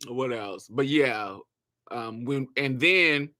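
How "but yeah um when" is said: usual speaking voice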